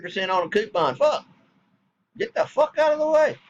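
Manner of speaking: high, heated scolding; angry rant. Retold or imitated. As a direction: tear into them for complaining over nothing. Scolding petty coupon arguers.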